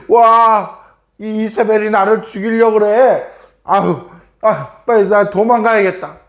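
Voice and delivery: storyteller's lightly panicked exclamations; mock fright. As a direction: play a frightened man deciding to flee. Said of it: "너무나 무서워가지고".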